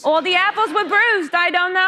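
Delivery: put-on high, whiny New York accent. Actress riffing about apples, high New York accent. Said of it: "a New York accent".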